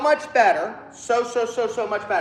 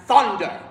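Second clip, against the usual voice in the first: "thunder" struck hard on the first syllable; thunderous word; 3 semitones higher, 5 dB louder than usual. Shown as a demonstration. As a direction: make "thunder" crack like thunder. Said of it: "needs to sound like thunder".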